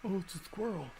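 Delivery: high, mocking sing-song of clueless people. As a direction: scoff at everyone who calls it a squirrel. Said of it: "People must be blind".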